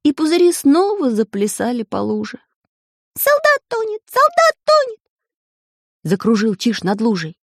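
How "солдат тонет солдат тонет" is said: very high repeated alarm; alarmed bird cry. A narrator's reading. Siskin raises alarm.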